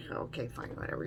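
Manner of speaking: a careless okay fine whatever; dismissive mutter. Retold or imitated. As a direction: brush it off under your breath. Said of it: "I'm like".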